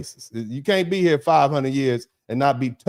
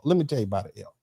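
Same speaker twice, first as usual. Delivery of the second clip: "Let me tell you about an L" said lower and softer; softer repeat; 5 semitones lower, 6 dB quieter, much faster than usual. Shown as a demonstration. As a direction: drop the voice for the repeat.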